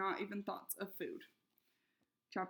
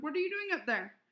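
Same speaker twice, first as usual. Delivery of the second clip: raised calling voice; 7 semitones higher, 4 dB louder than usual. A narrator's reading. Mother calling up to Flora, raised voice. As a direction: call up the stairs to her. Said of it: "her mother shouted".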